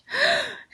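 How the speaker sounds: high whispered gasp; a sharp breath at the sight; gasp of surprise. Acted. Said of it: "and gasped".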